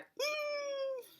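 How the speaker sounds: high, strained closed-mouth hum; frustrated squeal. Retold or imitated. Mom at her limit with the chaotic house, frustrated squeal. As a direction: tight high-pitched "mm" of overload.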